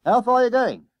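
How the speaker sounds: loud, high-pitched demand; bus conductor. Acted. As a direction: call out like a conductor after fares. Conductor demands fare from boys.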